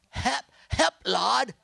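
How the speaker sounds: put-on high, loud Ozark accent cry; Bob Jones's Ozark accent. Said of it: "with his Ozark, uh, uh, uh, ex-, uh, accent".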